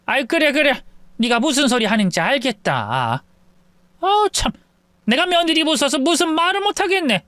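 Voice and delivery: mother-in-law grumbling as she backs down; grudging mother-in-law. Acted. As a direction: concede with a huff.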